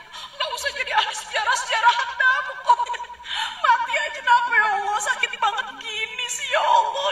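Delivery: high, loud, wailing complaint; Maryam in labor pain. Retold or imitated. Maryam wailing at God in childbirth pain. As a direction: cry out through the pain, half laughing. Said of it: "ngomongnya kayak gitu".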